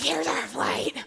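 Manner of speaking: panting, crying out in relief; breathless cry. Retold or imitated. Mother running up to the gate, breathless cry. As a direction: shout it as you arrive.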